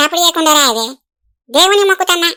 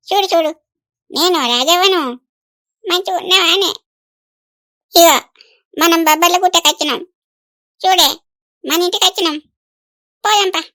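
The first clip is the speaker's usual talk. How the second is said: usual speaking voice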